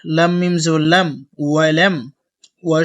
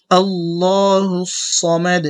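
আল্লাহু drawn out long and melodic; slow recited Allahu; much slower than usual. Shown as a demonstration. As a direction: stretch আল্লাহু slowly, recitation style. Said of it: "আবার বলি".

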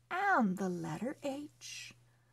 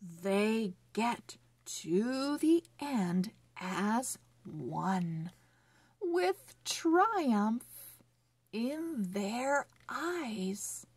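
slow, careful storybook reading; reading to children; slower than usual. Acted. Teacher reading a phonics story to children, slow and clear. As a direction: read each word clearly for young readers.

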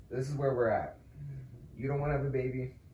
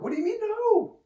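much higher squeaky mock protest; mock outrage; 6 dB louder than usual. Boyfriend mock-protesting his girlfriend, high squeak. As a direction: squeak out a fake indignant question.

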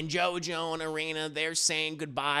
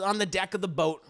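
ordinary delivery, nothing marked

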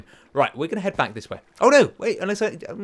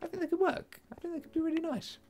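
1 squeaky voice; 13 semitones higher, 12 dB quieter than usual. Pretend. Squeaky voice, very high pitch.

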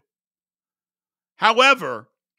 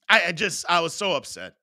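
ordinary talk

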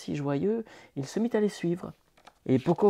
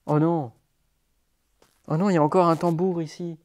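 ordinary delivery

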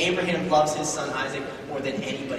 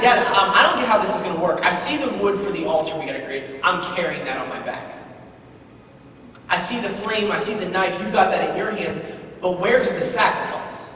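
pleading son; 3 semitones higher than usual. Acted. Son questioning his father about the missing sacrifice, pleading. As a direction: plead as a puzzled boy, pressing the point. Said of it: "he starts pleading with his dad".

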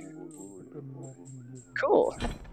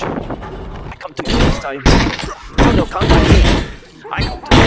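breathy whisper taunt; 6 dB louder than usual. Pretend. Unvoiced taunting game chant.

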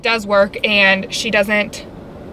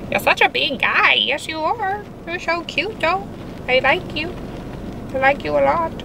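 cooing to pets; 7 semitones higher, 3 dB quieter than usual. Real, not acted. Cooing to her pet spiders.

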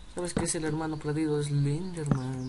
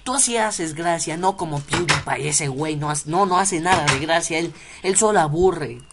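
fans reassuring him; 4 dB louder than usual. Retold or imitated. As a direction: protest like a loyal fan, getting louder. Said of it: "luego dicen". (imitando a fans indignados)

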